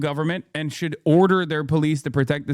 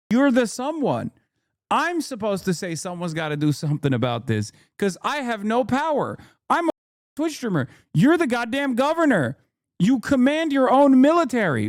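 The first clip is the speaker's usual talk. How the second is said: exasperated rant; 6 semitones higher than usual. Real, not acted. Ranting at passive Democrat governors.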